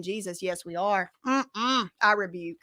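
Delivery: ordinary talk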